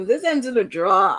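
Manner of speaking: usual speaking voice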